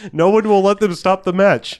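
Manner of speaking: usual speaking voice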